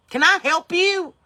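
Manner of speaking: mock customer-service sweetness; sarcastic snap. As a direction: bite back with mock politeness. Snapping at nosy woman.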